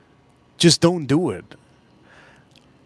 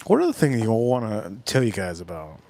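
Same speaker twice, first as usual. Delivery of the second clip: ordinary talk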